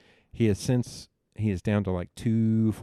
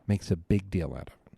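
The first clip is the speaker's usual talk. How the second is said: ordinary delivery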